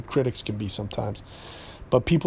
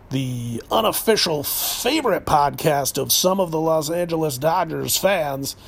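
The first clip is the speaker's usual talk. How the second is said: podcast announcer. Acted. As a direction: announce the show with mock importance, stretching key words. Podcast station-ident announcer bit.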